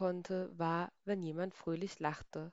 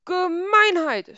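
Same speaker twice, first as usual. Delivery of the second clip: much higher, much louder outraged slow cry; furious shout; 12 semitones higher, 13 dB louder, slower than usual. A narrator's reading. Herr Grieskram stranded on the rainbow, outraged high shout. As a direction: yell it like a stamping grump. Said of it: "schrie Herr Grieskram".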